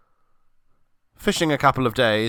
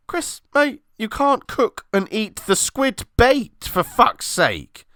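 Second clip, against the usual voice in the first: exasperated scolding; 12 semitones higher, slower than usual. Acted. Scolding Chris over bait.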